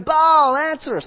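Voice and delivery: high loud mock cry of Baal; hammed-up plea to Baal. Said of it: "they cry out".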